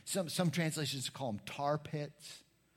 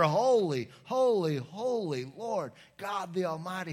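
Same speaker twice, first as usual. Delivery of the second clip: loud proclamation; 5 semitones higher, 6 dB louder than usual. Acted. Opening proclamation of scripture.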